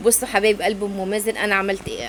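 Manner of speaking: ordinary talk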